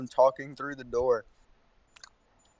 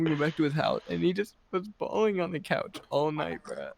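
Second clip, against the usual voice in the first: usual speaking voice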